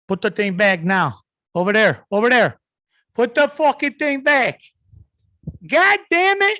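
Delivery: building from firm to a furious high yell; escalating rant about putting the thing back; angry Italian yelling. Retold or imitated. A frustrated Italian guy yelling at his family about the thing. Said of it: "I start raising my voice a little bit".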